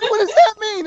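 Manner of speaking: very high, shrieking disbelief; panicked scream. Retold or imitated. Boy hearing his dad found the number, high panicked shriek. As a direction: shriek it like your world just ended. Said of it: "And I'm, like, screaming".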